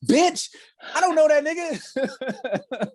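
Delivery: high, slow, scornful outburst; a mock outraged shriek; indignant retort. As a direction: squeal it out, fed up and offended. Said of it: "I was like".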